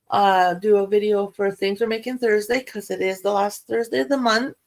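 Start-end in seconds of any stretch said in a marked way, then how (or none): none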